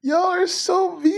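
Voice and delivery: very high, drawn-out whine; mock-hurt wail. Mock-hurt at chat teasing her accent.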